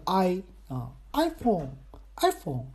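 "iPhone" slowed down, stressed on the "I"; stress on first syllable. Shown as a demonstration. Host demonstrating stress for listeners, slow and front-stressed. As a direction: lean on "I" in "iPhone", let "Phone" drop. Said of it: "那个重音在前面是I".

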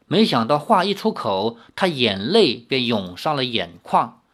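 ordinary delivery, nothing marked

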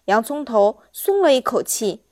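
plain reading voice

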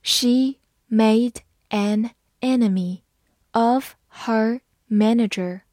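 slow, clear word by word reading. Shown as a demonstration. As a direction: read it slowly for learners. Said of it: "好，慢读一遍。". (慢速朗读)

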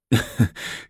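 soft breathy heh-heh chuckle; sheepish chuckle. Acted. Emperor laughing off the empress's suspicion, sheepish chuckle. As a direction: laugh it off to dodge the question. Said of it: "李治嘿嘿地笑着".

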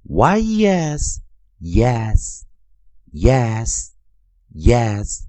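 very slow Y E S, then yes chanted three times; spelling drill. Shown as a demonstration. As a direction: chant yes letter by letter, slowly. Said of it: "但是已经".

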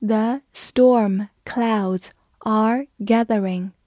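very slow, word-by-word model reading; deliberate, drawn-out demonstration for learners; slow read-through. Shown as a demonstration. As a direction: leave a gap after each word. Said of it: "慢慢来读".

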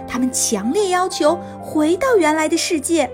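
usual speaking voice

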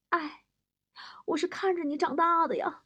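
soft, high lament; wistful sighing. Acted. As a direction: sigh in mock sorrow. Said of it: "不由得感慨". (轻声感慨，带着叹息)

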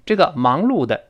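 ordinary talk

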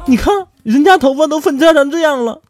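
high, loud, laughing complaint; wife whines about split ends; jealous whine. Retold or imitated. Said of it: "他对比了一下".

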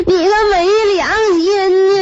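hammed-up, high-pitched wail; crying while talking. Feverish wife accusing her husband, hammed-up tearful wail. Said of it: "我一边哭就一边说".

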